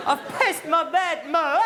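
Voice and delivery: put-on high, slow, loud child's wail; call going up. Shown as a demonstration. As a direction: send the call for Mum up high. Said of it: "it's gotta go up".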